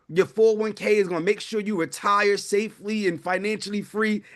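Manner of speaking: ordinary talk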